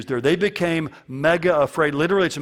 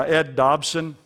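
normal talking voice

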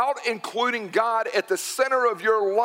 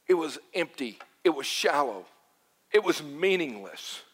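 low, subdued verdict with falling endings; grim mock eulogy; 5 semitones lower, 4 dB quieter than usual. Preacher acts a funeral for a pointless life, grim. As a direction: pronounce each short sentence low and final.

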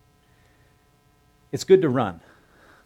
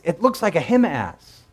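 high, loud and slow, peering into the distance; excited recognition; 9 semitones higher, 9 dB louder, slower than usual. Retold or imitated. Watchman recognizes the runner, excited and drawn out. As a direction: call it out as the face comes clear.

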